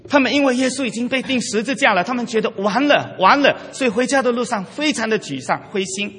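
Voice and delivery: ordinary delivery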